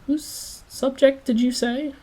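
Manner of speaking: high, slow, loud disbelieving question; a stout man baffled and demanding; incredulous businessman. Acted. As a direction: stretch it out in rude disbelief. Businessman asks again, incredulous.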